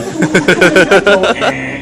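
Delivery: rising, repeated electronic bloops; imitated Pong blips. Recalling old video games, mimicked Pong noises. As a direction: imitate a primitive video game's beeps. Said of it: "I had played Pong".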